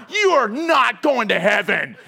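loud scolding broken by laughter; laughing mock scold. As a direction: scold him through your own laughter. Mock scolding Frank while laughing.

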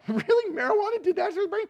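high, fast disbelieving question; shocked disbelief. Retold or imitated. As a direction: pitch up in shock and rush it. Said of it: "And I went".

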